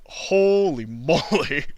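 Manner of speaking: slow laughing exclamation. Real, not acted. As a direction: stretch it out through a laugh. Amused reaction.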